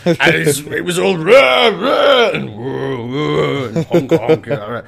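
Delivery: put-on tipsy old colonial gent, loud and rambling; lightly hammed drunk colonial type describing the stampede; Rowley Birkis. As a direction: slur it like a drunk old buffer. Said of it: "Rowley Burkis from, um, from the Fast Show".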